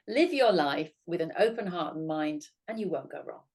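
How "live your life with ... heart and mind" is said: ordinary talk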